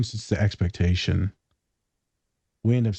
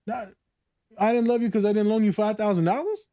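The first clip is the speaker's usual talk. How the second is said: much higher, disbelieving outrage; incredulous retort; 9 semitones higher than usual. Retold or imitated. Answering a guilt-tripping parent, high incredulous voice. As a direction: squeak with offended disbelief.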